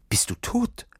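high, hushed shock; a jolt of fear, half-breathed; frightened thought. Acted. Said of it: "durchzuckte es Nils".

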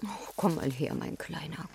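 tender whispered comfort; soft whisper. Acted. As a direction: whisper gently to soothe. Mutter tröstet Kind. (zärtliches Flüstern)

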